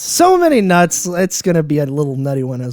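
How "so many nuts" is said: high, loud playful echo; excited echo. Real, not acted. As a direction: toss the line back with glee. Echoing partner's line, high and loud.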